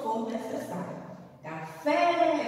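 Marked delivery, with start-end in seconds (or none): 1.4-2.5 Café with a long, loud, open FÉ; open stressed final vowel. Shown as a demonstration. Teacher showing the open sound for the accent, FÉ drawn out. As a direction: hold the open "fé" of "Café" high. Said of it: "é um som aberto".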